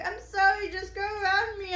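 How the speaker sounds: very high, loud, near-tearful plea; retold hysterical. Stranded driver apologizing to honking cars, retold hysterical wail. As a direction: re-enact a desperate wail to drivers. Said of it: "hysterical. I'm, like, freaking out".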